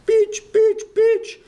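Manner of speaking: piercing loud mock yell of a name. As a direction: belt the name out in the driver's accent. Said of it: "a Pakistani bus driver coming in going".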